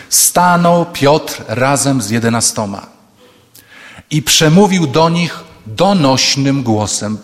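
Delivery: very slow, weighty scripture read; solemn reading. Shown as a demonstration. Preacher reading scripture to congregation, slow and solemn. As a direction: savour each word slowly. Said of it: "I uwaga, co mówi".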